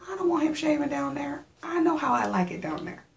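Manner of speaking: high, sassy indignant refusal. Real, not acted. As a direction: push back with attitude. Indignant refusal of friend's idea.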